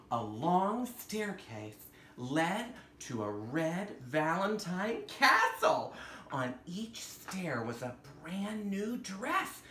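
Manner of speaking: gentle storybook narration with wonder; story reading to children. Acted. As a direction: read it like a bedtime picture book. Reads picture book aloud.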